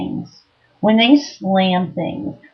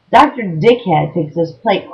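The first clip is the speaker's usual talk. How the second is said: ordinary talk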